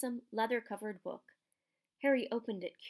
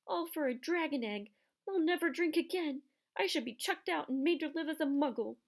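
high, tearful self-reproach; Hagrid, grief and remorse; 8 semitones higher, 4 dB louder than usual. A narrator's reading. Remorseful gamekeeper confessing to Harry, sobbing with grief. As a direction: lament in a higher, crying voice. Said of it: "shaking with grief and remorse".

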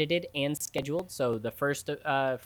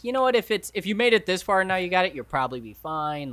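higher, louder breezy voice; casual shrug; 6 semitones higher, 5 dB louder than usual. Retold or imitated. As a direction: toss it off like it's nothing. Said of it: "Everyone's like".

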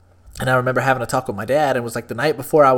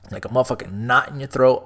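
ordinary talk

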